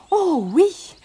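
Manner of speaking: high, proud little exclamation. Acted. Barbotine answers the prince, proudly. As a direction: answer with pride, pitched high. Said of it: "dit-elle fièrement".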